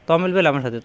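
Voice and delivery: ordinary talk, nothing marked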